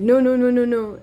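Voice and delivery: mock dismissive refusal; imitated refusal. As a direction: imitate a shop worker refusing emphatically. Store staff refusing her request.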